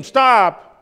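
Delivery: sharp shouted stop; rabbi's stop. Acted. Rabbi halts the first disciple, shouting stop. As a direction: cut the boy off with a shout.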